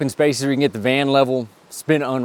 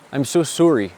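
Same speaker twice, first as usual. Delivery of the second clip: mock Canadian. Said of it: "what's the Canadian thing to say?".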